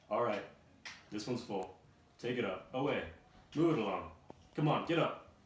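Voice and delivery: loud barking orders, rising at the end; gruff guard yelling commands; shouting guard. Acted. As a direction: bellow at the prisoners to move. Guard herding caged figures.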